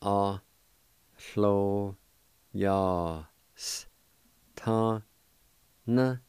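"A clo yas ta Na" broken into separate syllables. Shown as a demonstration. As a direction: pronounce "A clo yas ta Na" one syllable at a time. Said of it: "and it's pronounced like this".

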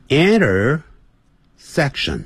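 very slow "Intersection" with a curled r; slow American intersection. Shown as a demonstration. Teacher slowly modelling the American r for students. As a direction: stretch each syllable, roll the tongue on r. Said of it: "美式音标碰到R的时候要卷舌的".

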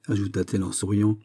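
ordinary delivery, nothing marked

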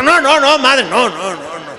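high loud flustered denial; panicked protest. Acted. Priest protesting to the nun, high panicked no. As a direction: protest in a rush.

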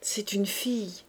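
soft, weak whisper; faint whisper of a new mother. A narrator's reading. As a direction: breathe it out, exhausted. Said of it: "elle murmura".

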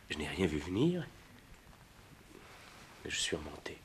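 a guilty, breathless whisper; hushed whisper. Acted. Lover confessing to Clotilde he came back up, whispering. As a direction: let it slip out almost unvoiced.

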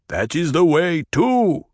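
stately Fremen chief; grave elder. A narrator's reading. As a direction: Stilgar backing Paul before the crowd, firm. Stilgar speaks in council.

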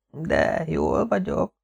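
soft, very slow, choked murmur; strangled whisper. A narrator's reading. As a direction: squeeze it out weakly, as if being choked. (elfojtott, fojtott hangú suttogás)

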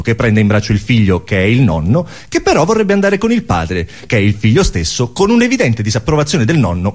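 ordinary delivery

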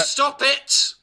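high-pitched cry to stop; exasperated outburst. Acted. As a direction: cut the actor off, pitch shooting up. Director snaps at actor.

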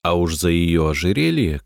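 usual speaking voice

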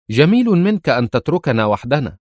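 calm and composed, lifted in pitch; calm female voice. A narrator's reading. Tia surprising Jackson in his office, lightly voiced calm woman. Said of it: "صوتا أنثويا هادئا يقول".

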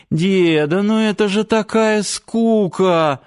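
high-pitched, drawn-out whine; bored groan. A narrator's reading. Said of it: "простонала девушка". (страдальческий высокий стон от скуки)

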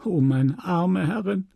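a first tearful wail; tearful lament. Acted. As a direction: lament over her dead mistress. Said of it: "Félicité schluchzte".

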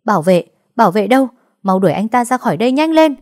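loud, high and fast calling out; calling for security. Acted. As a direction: shout urgently for the guards. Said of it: "luôn miệng gọi bảo vệ".

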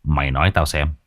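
put-on low, hushed eager old man; curious old chief. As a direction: lean in wanting the secret. Lý An intrigued, lowered. (giọng lão Lý tò mò, trầm)

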